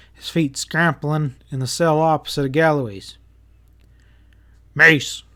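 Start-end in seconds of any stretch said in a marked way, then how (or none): 4.7-5.2 sharp loud call across the cells; piercing call. A narrator's reading. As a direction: call his name out hard. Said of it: "Pat called out piercingly".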